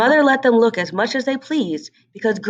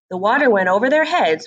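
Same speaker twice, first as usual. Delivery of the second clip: plain reading voice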